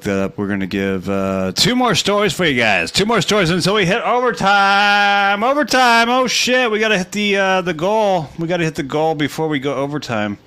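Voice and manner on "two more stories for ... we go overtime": high, loud hoarse hype; hoarse sleep deprived hype. Real, not acted. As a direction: pump up the crowd, raw-voiced. Hyping the stream goal.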